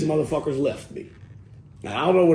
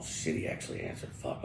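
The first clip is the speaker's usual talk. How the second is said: voiceless panicked whisper; whispered aside; 8 semitones lower, 13 dB quieter than usual. Retold or imitated. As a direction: drop to a breath, caught off guard. Aside while the phone is answered.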